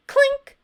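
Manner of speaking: high, loud clink; high clink sound. A narrator's reading. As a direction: ring it like metal. Sound word in story.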